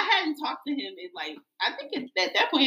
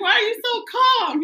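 high-pitched exasperated demand; 9 semitones higher than usual. Retold or imitated. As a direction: retell your disbelief, pitch way up. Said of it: "that pissed me off so bad".

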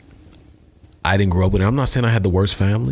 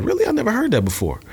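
mock surprise; faster than usual. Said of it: "And I was like".

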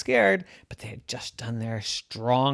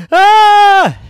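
shrill startled scream, very loud; high scream; 17 dB louder than usual. Acted. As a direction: let out a piercing scream. Isabel screams.

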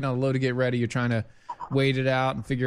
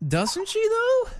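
much higher mocking squeak; high squeaky voice; 3 dB louder, much slower than usual. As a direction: squeak it out in mock agreement. Mocking agreement in put-on voice.

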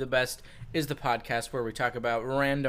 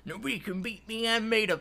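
cocky TV contestant; 10 semitones higher than usual. Retold or imitated. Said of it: "this guy was like".

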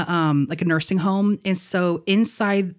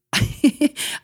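breathless giggle; 9 semitones higher than usual. Real, not acted. Laughing at own story.

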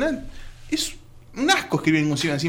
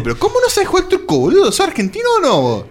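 mocking friend; 4 dB louder than usual.

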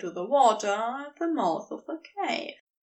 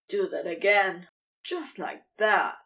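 low, breathy-free urgent plea; urging serpent lover; 5 semitones lower than usual. Acted. Crowley urging Aziraphale to keep going, low and eager. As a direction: ask for more, low and close.

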